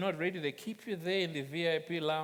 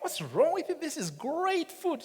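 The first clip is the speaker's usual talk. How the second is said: higher, puzzled husband defending his cooking; mock surprise; 12 semitones higher, 3 dB louder than usual. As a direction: act baffled she doesn't like it. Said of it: "I'm like".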